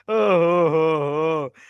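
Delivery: slow low hammed-up villain laugh; playful villain cackle at a nervous partner. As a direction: let out a slow mock evil ho ho ho.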